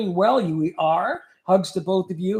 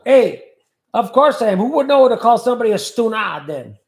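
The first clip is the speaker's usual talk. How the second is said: loud, high Italian bravado; mock Italian; 4 semitones higher, 6 dB louder than usual. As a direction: play the proud paisano.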